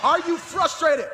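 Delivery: high, forceful rallying question; punched, high-pitched preacher's challenge; emphatic repeat. Real, not acted. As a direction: fire the question back at the crowd. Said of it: "Do I need to run that back?".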